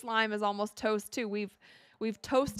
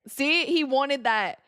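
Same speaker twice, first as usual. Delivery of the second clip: told-you-so; 5 semitones higher, 6 dB louder, slower than usual. Real, not acted. Rival star again.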